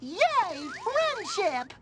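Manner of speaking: high-pitched gleeful squeal; delighted cheer. Acted. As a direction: cheer like an overjoyed innocent friend. Delighted at being befriended.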